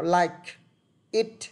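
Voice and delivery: LIKE and IT held apart by a pause; two separate words, "like ... it"; slow, unlinked. Shown as a demonstration. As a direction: say "like" then "it" slowly, with no linking. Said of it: "Còn chậm thì nó chỉ có".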